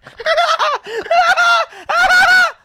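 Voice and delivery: shrieking high-pitched mushroom laugh. Retold or imitated. High teen cackling in front of his mom. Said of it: "you know that high laugh".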